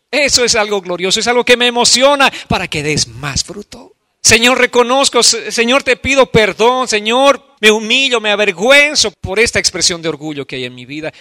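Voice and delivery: high, loud impassioned preacher's cry; a pastor crying out a prayer of repentance before the church; fervent preaching. Acted. Preaching at full force.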